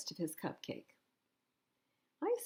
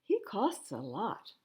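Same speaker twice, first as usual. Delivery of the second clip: ordinary delivery, nothing marked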